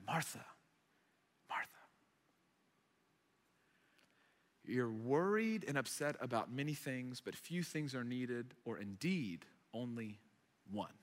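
calm her down quietly, with long pauses. Retold or imitated. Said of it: "He's just trying to, to calm her down".

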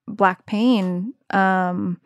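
usual speaking voice

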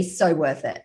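normal talking voice

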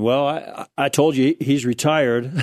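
ordinary talk, nothing marked

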